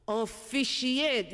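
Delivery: "officiate" stretched out slowly, syllable by syllable; slow drawn-out realization. Acted. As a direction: savour "officiate" as if the penny drops. Realizes the word comes from fish.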